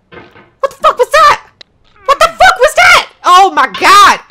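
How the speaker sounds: can't believe what just happened; shocked shout. Real, not acted. Gamer shrieks in shock at a sudden fall.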